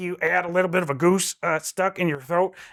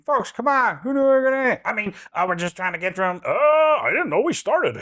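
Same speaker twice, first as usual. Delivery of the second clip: Joe Biden impression; 7 semitones higher than usual. Biden closing out the segment, higher. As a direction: pitch up and bluster.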